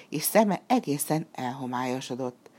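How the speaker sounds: plain reading voice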